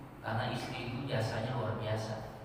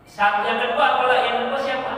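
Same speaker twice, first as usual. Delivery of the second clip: high, loud quiz; loud preaching question; 10 semitones higher, 11 dB louder than usual. Acted. Preacher quizzing the congregation on the second thing, loud. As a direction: call it out to the crowd.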